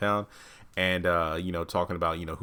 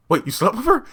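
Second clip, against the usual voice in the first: much higher, louder disbelief; shocked; 14 semitones higher, 7 dB louder than usual. Retold or imitated. River hearing Daikon slept with Ruth, shocked. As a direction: blurt it out in shock.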